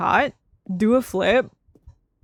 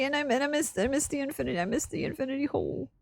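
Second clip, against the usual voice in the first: much softer, trailing lament; mock grief, softer; 2 semitones higher, 9 dB quieter, faster than usual. Real, not acted. Streamer mourns the lost hole, softly. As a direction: mourn it in a hushed voice.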